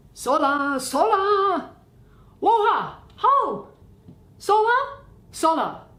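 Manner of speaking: loud, high calls across the dark; hollering. Acted. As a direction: bellow the name out to someone far off. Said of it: "Leave hollering".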